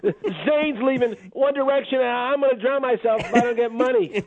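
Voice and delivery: high-pitched whiny son; hammed-up distraught whine. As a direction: threaten like a spoiled, wailing kid. Plays the whining son.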